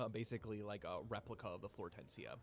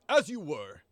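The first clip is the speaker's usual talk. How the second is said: high, loud pompous dismissal; 6 semitones higher, 15 dB louder than usual. Acted. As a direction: puff up and call it grandly. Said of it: "in a very, like, pompous voice".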